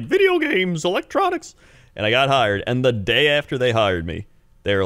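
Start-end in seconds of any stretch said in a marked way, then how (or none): 0.0-1.5 high, gushing mock-teen voice; excited teenager. Teenager dreaming of a dream job, excited. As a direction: squeal it like a kid dreaming of electronics. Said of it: "I was a teenager and I was like".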